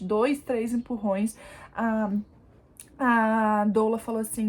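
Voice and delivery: ordinary delivery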